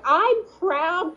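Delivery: high, slow, puffed-up voice; mocking a Midwesterner who calls himself Norwegian; mock proud Midwesterner. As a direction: parody someone proud of distant roots. Mocking Midwesterners claiming heritage.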